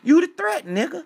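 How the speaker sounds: high, loud repeated yell. Retold or imitated. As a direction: shout it at him, building to a peak. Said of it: "Like Unc said".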